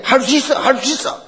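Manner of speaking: high-pitched rallying cry, retold; rally a losing team in a high voice; urging on teammates. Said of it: "기죽은 선수들이 힘을 넣어주면서". (동료들을 독려하는 높은 목소리)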